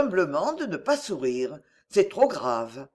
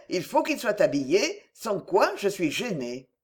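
usual speaking voice

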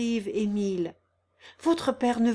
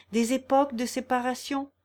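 ordinary delivery, nothing marked

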